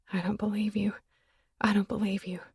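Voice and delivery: soft breathless whisper; whispered disbelief. Acted. Woman whispering disbelief at her lover. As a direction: whisper it to yourself in shock. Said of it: "My spirit screamed it".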